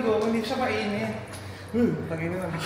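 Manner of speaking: usual speaking voice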